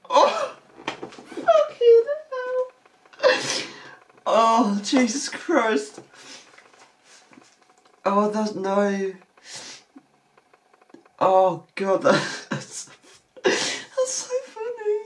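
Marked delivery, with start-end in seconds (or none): 0.1-3.7 high, slow, strangled laughing wail; laugh-crying. Real, not acted. Watching the rude cartoon, helpless slow laugh-cry. Reactor cracking up at clip. 13.4-15.0 high, softer squeak through laughter; crying with laughter as you say it; laughing tears. Real, not acted. Reactor overcome by the clip, squeaky laugh-cry.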